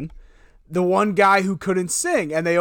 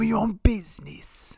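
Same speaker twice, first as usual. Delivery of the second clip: hushed, slow impression; whispery put-on voice, quietly savoured; Lisa Vanderpump; 2 semitones higher, 5 dB quieter, slower than usual. Riffing on the Manila folder catchphrase, whispery impression. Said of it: "Lisa Vanderpump".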